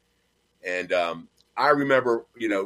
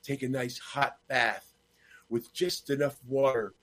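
soft, slowing, lightly played sitcom seductress; aggravated underneath, keep the smile and savor each phrase; hushed, leisurely put-on sweetness; Blanche; 5 dB quieter than usual. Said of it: "but keeps smiling".

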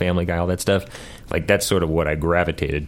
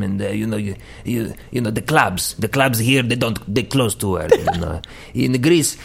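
put-on higher-pitched Greek party-guy accent; Greek Guido accent; 3 semitones higher than usual. Guest mimics a Greek Guido from the family, Greek accent. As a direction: play a clubbing Greek Guido with a thick accent. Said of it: "almost if you had, like, a Greek Guido".

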